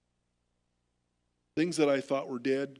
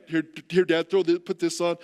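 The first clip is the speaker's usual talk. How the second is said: urgent call; 2 semitones higher, 7 dB louder than usual. Retold or imitated. Nurses rushing, calling orders.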